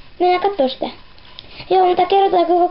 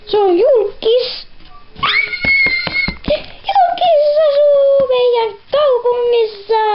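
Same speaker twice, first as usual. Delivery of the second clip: excited squeal; 10 semitones higher, slower than usual. Acted. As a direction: gush that a celebrity lives here. Excited announcing a celebrity. (innoissaan kiljuen)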